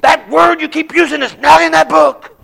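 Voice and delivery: high, loud furious yell; angry scream. Retold or imitated. Inmate yells at the preacher over the Bible, furious. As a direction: bellow it at him, pointing at the Bible. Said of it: "he's screaming at me".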